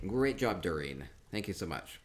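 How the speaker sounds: ordinary talk, nothing marked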